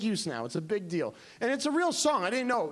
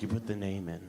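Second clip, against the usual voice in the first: much lower, calm explaining; deadpan Mildred; 11 semitones lower, 6 dB quieter than usual. Retold or imitated. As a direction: explain it low and matter-of-fact. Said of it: "She's like".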